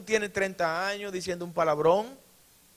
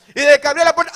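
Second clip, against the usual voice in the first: ordinary talk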